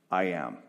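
low, weighty "I am"; emphatic. Acted. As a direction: say "I am" as a firm, solemn declaration. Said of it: "that is utterly emphatic".

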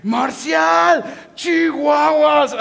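loud, high mock shout at her husband; imitating an exasperated wife yelling; wife yelling at husband over the shoes, playful mock outrage; angry yell. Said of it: "And she gets super angry. And she's like".

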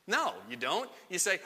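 normal talking voice

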